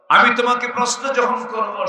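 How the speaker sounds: high, loud preacher's cry; preaching at full force. Acted. Preacher crying out to the congregation at full force. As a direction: lift the crowd with a loud call.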